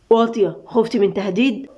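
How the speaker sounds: ordinary delivery, nothing marked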